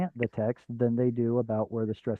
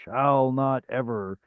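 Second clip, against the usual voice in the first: "shall not ever" slowly spaced out, each word weighted; emphatic rule; slower than usual. Shown as a demonstration. Teacher laying down a rule.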